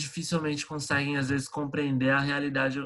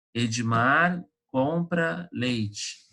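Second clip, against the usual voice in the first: slow example sentence; much slower than usual. Shown as a demonstration. Teacher gives example sentence slowly.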